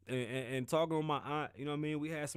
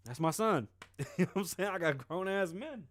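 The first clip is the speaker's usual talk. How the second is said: ordinary talk, nothing marked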